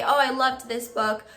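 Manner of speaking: ordinary talk, nothing marked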